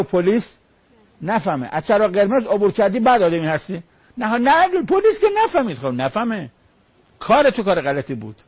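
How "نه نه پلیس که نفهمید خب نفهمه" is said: mock excuse. Mimics excuse of a wrongdoer.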